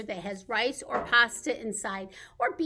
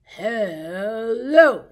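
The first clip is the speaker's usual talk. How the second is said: a deeper, lower hello; 3 semitones lower, 9 dB louder than usual. Shown as a demonstration. Leading babies in saying hello as low as possible. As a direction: drop your voice down low. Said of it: "as low as we can".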